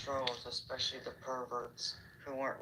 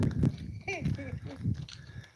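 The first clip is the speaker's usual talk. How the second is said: kind gentle laugh; 13 semitones higher, 4 dB louder than usual. Real, not acted. Laughing at a chat joke.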